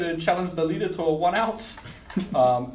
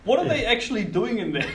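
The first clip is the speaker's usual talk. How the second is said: higher, giggly question; laughing; 6 semitones higher, 5 dB louder than usual. Real, not acted. Player asks about goblin printers, laughing. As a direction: ask it through real laughter.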